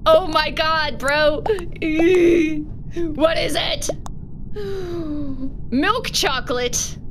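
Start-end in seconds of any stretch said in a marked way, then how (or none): 1.5-2.9 startled breathy gasps; dramatic gasps. Real, not acted. Waiting to learn the chocolate, dramatic gasps. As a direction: gasp in mock shock.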